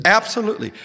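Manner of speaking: high, joyful shout of absolutely; jubilant absolutely. Retold or imitated. Colleague jumping on the table to forgive, shouting joyfully. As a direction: leap in with total delight. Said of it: "he jumped on the table and he said".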